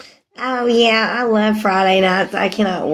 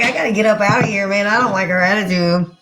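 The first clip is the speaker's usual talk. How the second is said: retold exasperation; faster than usual. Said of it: "I was like".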